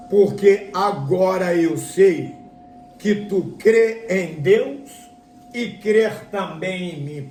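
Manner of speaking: slow, emphatic proclamation from heaven. Acted. Preacher voices heaven affirming Abraham's faith, slow proclamation. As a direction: stretch each phrase out like a divine pronouncement. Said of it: "E aquela voz diz assim".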